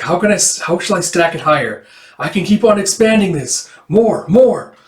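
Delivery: high, loud, mounting eagerness; excited eagerness. Acted. Storyteller absorbed in expanding her tale, high growing excitement. Kid absorbed in stacking tower higher.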